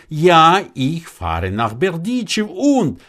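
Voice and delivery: put-on baffled young man, voice lifted; puzzled wonder. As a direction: push the final question up high. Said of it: "wundert sich wieder der Junge".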